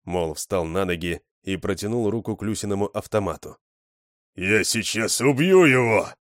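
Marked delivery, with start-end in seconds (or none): 4.3-6.1 loud, slow, menacing threat; furious threat. Acted. Mol threatening to kill the little one, loud and slow. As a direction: spit out the threat slowly.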